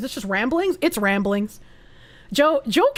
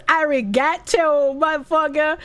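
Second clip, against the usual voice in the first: imitating Sawa's delivery. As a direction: stretch it out, mimicking a voice actress's line. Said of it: "just the way she says".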